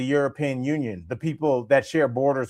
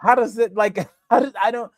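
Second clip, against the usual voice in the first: laughing; 8 semitones higher, 3 dB louder than usual. Real, not acted. Laughing in disbelief.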